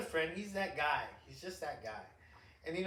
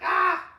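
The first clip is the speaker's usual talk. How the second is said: a sudden loud, high cry of a fed-up father; crazy dad yell; 14 semitones higher, 14 dB louder than usual. Retold or imitated. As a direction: snap into crazy dad mode with one shout. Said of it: "I get into that crazy dad mode".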